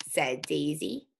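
normal talking voice

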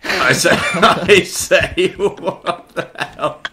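slow, drawn out through laughter; slow laughing. Real, not acted. Watching the game chaos, a slow laughing disbelief.

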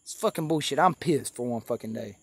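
ordinary delivery

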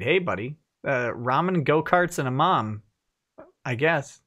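ordinary delivery

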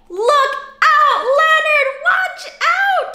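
high, loud warning shout to a character; calling out a warning. Pretend. Reader warning Leonard off the snake, loud high call. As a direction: yell to Leonard to watch out.

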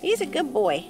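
ordinary delivery

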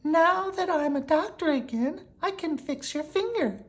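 very high-pitched character voice; put-on high doctor voice; exclaiming doctor. A narrator's reading. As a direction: exclaim it in a squeaky storybook voice. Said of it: "exclaimed the doctor".

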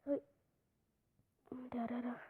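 breathless whispered wait, don't scare it off; hushed whisper. Real, not acted. Gamer hushing himself as he spots something, whispered. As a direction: whisper it, hold on, hold on.

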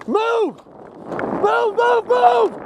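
urgent shout. Real, not acted. Urging team forward under fire.

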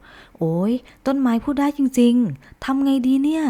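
high, slow startled cry; startled panic. A narrator's reading. Rabbit startled by the talking tree, alarmed. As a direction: jump back in alarm. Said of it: "กระต่ายสะดุ้ง".

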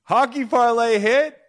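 high, loud jubilant announcement; excited shout. Real, not acted. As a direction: shout out the winning bet. Announces a winning hockey bet.

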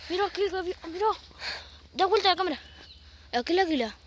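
excited. Real, not acted. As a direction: call out the bird, thrilled. Spotting an eagle.

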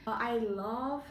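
ordinary talk

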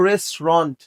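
"রেস্টুরেন্ট" ending in "ront", pitch falling; correct pronunciation. Shown as a demonstration. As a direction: say "রেস্টুরেন্ট" in two parts, "rest", "ront". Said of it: "তাহলে এটা কি বলবো".

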